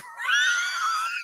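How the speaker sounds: can't hold back the laugh. Real, not acted. Laughing mid-story.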